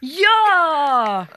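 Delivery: long, high cheer; big drawn-out yell; cheering shout. A narrator's reading. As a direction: shout it like a whole group. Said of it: "kaikki huusivat".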